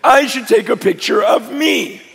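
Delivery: loud, high preaching at full force. Acted. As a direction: preach it out to the crowd.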